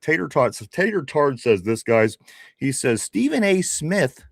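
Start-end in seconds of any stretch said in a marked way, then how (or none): 3.0-4.2 mock English accent. Host mimicking a British listener in an English accent. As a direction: pitch it up into a put-on English accent. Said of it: "this is how he sounds in my head. He's English".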